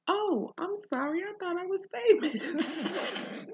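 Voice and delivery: high, slowed words breaking into laughter; laughing apology. Retold or imitated. Host jokes to the stranger while laughing at herself. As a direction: joke about being famous through a laugh. Retold joke reply, laughing.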